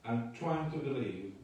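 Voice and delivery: ordinary talk, nothing marked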